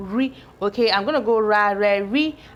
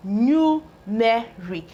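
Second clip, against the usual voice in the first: slow blending; much slower than usual. Shown as a demonstration. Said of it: "teacher blending full word slowly".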